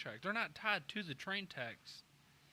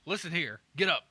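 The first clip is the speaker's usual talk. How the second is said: loud; 8 dB louder than usual. Acted. Dad barking at lazy son.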